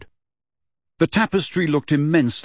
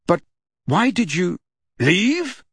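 put-on higher, slow boyish question; lightly voiced Harry; 8 semitones higher, slower than usual. Harry asking Sirius, slightly higher and slow. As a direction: ask it higher and slowly, like a boy.

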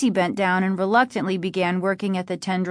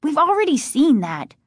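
higher, impatient snap; snapping; 7 semitones higher, 4 dB louder than usual. A narrator's reading. Margaret answering her brother's objection, snapping. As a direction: snap back, a touch irritated. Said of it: "Margaret snapped".

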